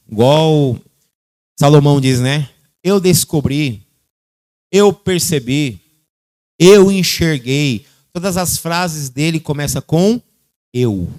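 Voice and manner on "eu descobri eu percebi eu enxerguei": lightly voiced sage, drawn out slowly; slow, weighty wise man. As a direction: slowly savour each I, like Solomon. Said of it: "O sábio diz assim". (sábio falando devagar e solene)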